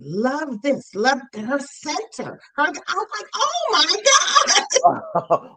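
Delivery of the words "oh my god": shocked, laughing. Retold or imitated. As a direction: replay the shock through laughter. Retelling shocked reaction, laughing.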